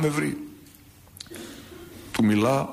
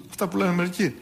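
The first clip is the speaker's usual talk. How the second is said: normal talking voice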